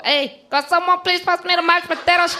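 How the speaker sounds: high, hammed-up Mexican accent. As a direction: put on a badly exaggerated Mexican accent. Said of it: "That was my best Mexican accent".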